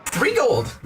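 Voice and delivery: high, slow squeaky shopkeeper; a very high, bouncy insect merchant; grasshopper shopkeeper. Acted.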